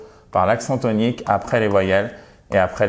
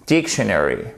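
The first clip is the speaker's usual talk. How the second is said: dictionary low, with DIC stressed and falling; first-syllable stress; 4 semitones lower than usual. Shown as a demonstration. Teacher modelling the understood version, stress on first syllable. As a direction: land firmly on the DIC of "dictionary". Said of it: "c'est sur la première syllabe".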